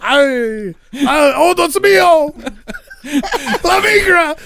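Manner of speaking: panicked mock Spanish-accented cry before running off; over-the-top Spanish accent with a laugh in it. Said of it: "I just put on my best Spanish accent".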